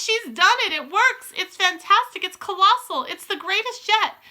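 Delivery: high, loud, gushing praise; jubilant cheering. A narrator's reading. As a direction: cheer like an adoring crowd. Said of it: "yelled the audience".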